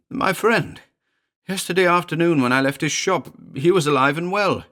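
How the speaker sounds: high, choked and strained; choking accusation. Acted. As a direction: force the words through a tight throat. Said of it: "Elliot choked".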